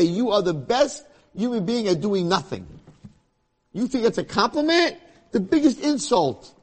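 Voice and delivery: high, loud jeering devil; scornful Satan. Acted. Satan mocks Yaakov's joy, loud and high jeering. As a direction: sneer at the fool, loud and high.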